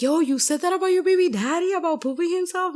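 high, loud mock voice of a startled friend; confronting friend. A friend calling her out about the podcast, mock high voice.

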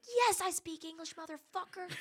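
put-on high, loud, cussing comeback to the kid; loud retort. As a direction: snap it back at him, loud and high. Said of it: "And I was like".

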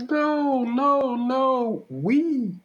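high wailing pleas, a mock cry; hammed-up distraught mother begging; imitating tearful wail. Mother hearing her son is Republican, mock tearful high wail. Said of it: "she begins to, to tear up and cry".

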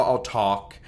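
normal talking voice

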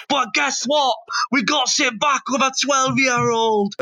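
high, shouted mock-Scottish punchline; attempted Scottish accent. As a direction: crow it triumphantly in put-on Scottish. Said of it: "But then he goes".

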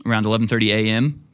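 normal talking voice

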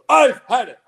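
loud, sharp retold outburst; angry outburst. Audience member erupting at the lecturer, loud. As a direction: blurt it out, fed up. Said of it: "and he goes".

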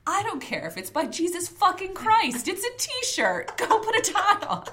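high, loud booming rant; voice that carries. Retold or imitated. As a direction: bellow it so the whole floor hears. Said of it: "in a voice that carries".

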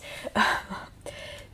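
airy laugh at herself; breathy laugh. Real, not acted. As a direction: let out a breathy giggle. Laugh at own joke.

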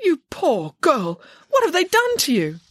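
aghast nanny, rising in pitch; horrified pity. A narrator's reading. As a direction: exclaim in shocked sympathy. Nanny horrified by girl's haircut.

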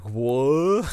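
loud, high dopey "What?"; dumbfounded yokel. Retold or imitated. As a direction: play a slack-jawed dumb man. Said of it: "slack-jawed, dumb man, like".

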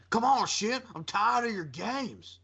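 high, slow, drawn-out whiny delivery; wrestler impression. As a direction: drag it out like a fed-up wrestler. Voicing AJ Styles on show.

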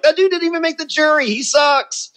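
high, fast, mocking; scoffing put-on voice. Telling casting what he thought of Boston Rob, high mocking scoff. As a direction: sneer it off in a silly high voice. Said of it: "I'm like".

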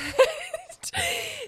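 laughing too hard to talk; high squealing laugh. Real, not acted. Cracking up mid-argument.

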